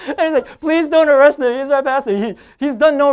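high, loud, laughing plea with the cop; pleading friend. Retold or imitated. Friend begging the police officer not to arrest his pastor, high and loud. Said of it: "He goes".

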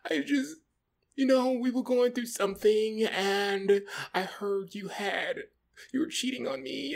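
put-on high, tearful girlfriend voice; mock tearful. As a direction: plead weepily, imitating the girlfriend. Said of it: "She's crying".

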